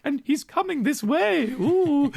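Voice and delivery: put-on high old Scottish lady voice. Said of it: "She's an old lady looking out the window being like".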